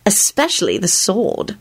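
slow, lightly merry addition. A narrator's reading. Elizabeth admiring Lupin's costume, merrily. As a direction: add it with playful relish. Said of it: "she added merrily".

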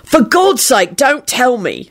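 high, irritated outburst; irritable bark. A narrator's reading. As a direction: cut a friend off irritably. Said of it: "Sirius barked out irritably".

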